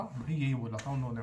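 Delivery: ordinary talk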